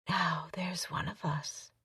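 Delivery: unvoiced, slow seductive whisper. A narrator's reading. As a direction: slowly whisper it into his ear. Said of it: "she whispered".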